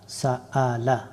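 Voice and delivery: সা আ লা read as one word after spelling; teacher reads the spelled word whole; joined reading. Shown as a demonstration. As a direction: put the syllables সা আ লা together in one breath. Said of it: "কয়েকটা উদাহরণ দেখে নেই".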